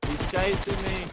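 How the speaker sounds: panicked, slow and high; frantic fear, enemy on his heels; being chased. Real, not acted.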